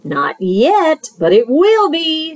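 lightly voiced smooth-talking fox; sly fox. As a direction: coax him like a friendly huckster. Fox's voice.

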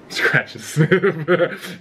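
slow amused laugh through words. Real, not acted. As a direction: chuckle it out slowly. Reads item then laughs at it.

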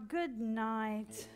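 ordinary delivery

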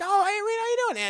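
light, high-pitched hello; imitating a sweet, high greeting; friendly greeting. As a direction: chirp it as an oblivious friend. Acting JoJo's friendly greeting.

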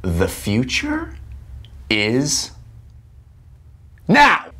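slow, building dramatic announcement; dramatic announcer. Pretend. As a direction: announce it slowly, then burst out. Announcer-style dramatic line.

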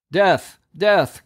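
high, sharp repeated cry; herald's cry. A narrator's reading. Chief herald crying out to the crowd, high. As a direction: announce death to the city. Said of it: "cries the chief herald".

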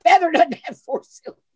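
exasperated linguist. Retold or imitated. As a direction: play the fed-up voice coach correcting him. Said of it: "She said".

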